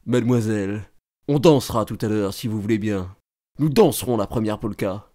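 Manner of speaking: low-pitched, softened invitation to dance; courting young man. Acted. Young man asks girl to dance. (galant, d'une voix grave)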